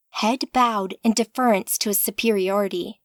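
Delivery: ordinary delivery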